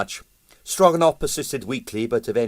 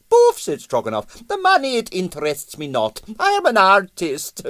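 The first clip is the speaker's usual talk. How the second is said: Russian impresario; 10 semitones higher, 3 dB louder than usual. Acted. Stroganoff voiced by narrator.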